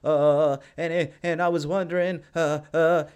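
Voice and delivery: mock fumbling for words; stumbling, hesitant imitation; mock hesitant stammer. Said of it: "There wasn't any".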